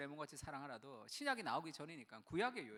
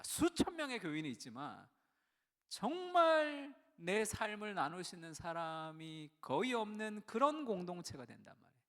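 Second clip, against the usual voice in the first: usual speaking voice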